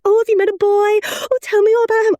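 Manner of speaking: high-pitched mock-excited mum; eager mother. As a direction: imitate a mother thrilled to hear the gossip. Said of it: "probably mothers more likely".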